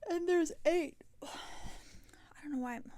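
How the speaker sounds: talking through a yawn; real yawning. Real, not acted. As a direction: let a yawn swallow the words. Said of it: "I do know why I'm yawning".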